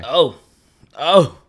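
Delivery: loud startled oh; loud groan. Real, not acted. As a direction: blurt it out loud at the taste. Tasting matcha, loud reaction.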